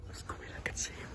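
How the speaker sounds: hushed, excited whisper. Acted. Zacchaeus hiding in the tree, whispering. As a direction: keep it secret, whisper fast.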